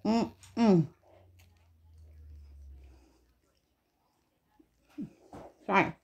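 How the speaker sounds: humming through a mouthful of food; mouth full. Real, not acted. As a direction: talk while chewing. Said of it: "Got a mouthful".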